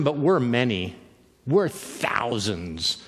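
low, slow menacing demon voice. Retold or imitated. Demon trying to scare Jesus off, slow and menacing. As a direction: stretch it out to intimidate.

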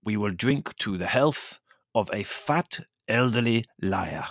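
slow, weighty ceremonial delivery; solemn toast. A narrator's reading. Servant toasting his insulted countess, solemn. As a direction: intone each word with mock gravity. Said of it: "he said to me solemnly".

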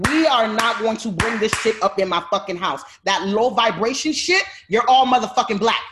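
loud, furious retold scolding at the family; shouting down the kids in her house; retold yelling. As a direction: yell at them to knock it off. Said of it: "I go in and I'm like".

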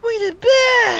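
high, loud drawn-out catchphrase shout. Acted. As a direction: yell it long and high like a hype man. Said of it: "was his name [name]".

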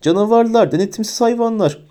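high-pitched outraged insults; shouted. A narrator's reading. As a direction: hurl it out in a high acted voice. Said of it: "diye bağırdı Umbridge". (tiz sesle, öfkeyle bağırarak)

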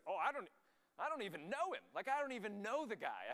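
high, loud mock protest; emphatic denial. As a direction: act out a loud, indignant denial. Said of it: "Peter goes".

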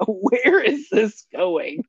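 drawn-out confused question; slow bewildered. Retold or imitated. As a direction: ask it slowly, baffled. Retold confused reaction.

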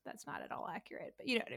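breathy unvoiced aside; whispered aside. Real, not acted. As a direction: drop to a whisper.

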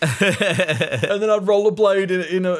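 put-on high excited kid fantasizing. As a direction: gush like a greedy kid.

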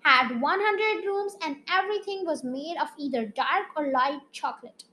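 lively storytelling read aloud; animated children's story reading; story narration. A narrator's reading. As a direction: read it like a bedtime chapter book. Reads a story chapter aloud.

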